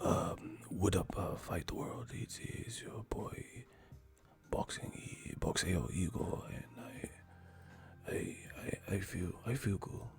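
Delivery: slow breathy live-show whisper. Pretend. Streamer greeting fans in a mock whisper. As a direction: whisper your stream intro. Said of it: "let me whisper on".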